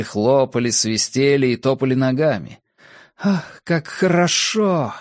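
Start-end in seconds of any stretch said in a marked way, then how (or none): 3.2-4.9 frenzied thought. A narrator's reading. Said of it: "неистовая мысль".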